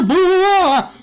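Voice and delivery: high eerie wailing howl; spooky howl noise. Pretend. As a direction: howl like a ghost in the trees. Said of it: "I made that boo-ah noise".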